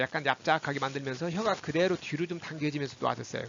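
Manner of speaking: ordinary talk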